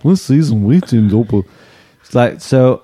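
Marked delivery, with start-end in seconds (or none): 0.0-1.5 lightly voiced Liverpudlian impression; a touch of put-on Scouse.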